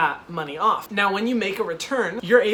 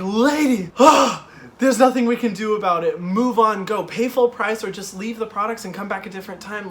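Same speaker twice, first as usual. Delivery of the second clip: louder, higher, fed-up snap at a customer; exasperated outburst; 3 semitones higher, 6 dB louder than usual. Retold or imitated. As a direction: let the frustration burst out. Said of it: "I'm so ready to scream at this lady".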